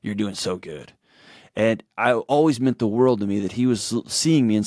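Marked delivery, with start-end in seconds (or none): 0.0-0.9 soft, lower, eyes-down mumble of pride; a hint of the awkward uncle, quietly proud; awkward quiet praise. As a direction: say it shyly, not looking at him. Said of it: "he wouldn't look you in the eyes kind of way".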